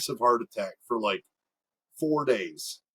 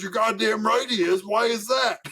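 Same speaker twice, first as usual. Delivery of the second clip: higher, combative retold retort; mock indignant; 7 semitones higher than usual. Dad confronts the driving teacher over his kid, mock indignant. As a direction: play an irate dad demanding an answer.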